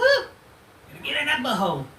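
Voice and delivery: high put-on mocking voice. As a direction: sneer it out in a silly high voice. Mocking someone, odd voice.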